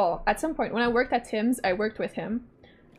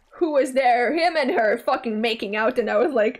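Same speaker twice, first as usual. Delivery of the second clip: ordinary delivery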